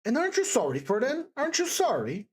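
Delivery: high, reproachful questioning; indignant, pleading rebuke; flying at him. A narrator's reading. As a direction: challenge him with lifted, insistent pitch. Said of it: "Sonia flew at him again".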